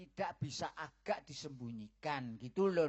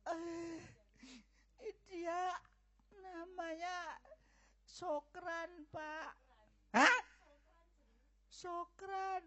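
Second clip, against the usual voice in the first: tearful confession; 12 semitones higher, much slower than usual. Acted. Daughter tearfully names the boy to her father. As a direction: force the name out while crying.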